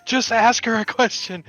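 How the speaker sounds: high, loud, anxious host; nervous showman hushing the crowd; urgent host warning. Acted.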